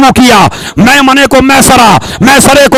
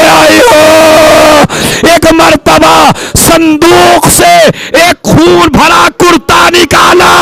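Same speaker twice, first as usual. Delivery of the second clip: keening elegy, pitched up and stretched; wailing majlis recitation; 3 semitones higher, slower than usual. Acted. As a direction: cry the grief out to the mourners.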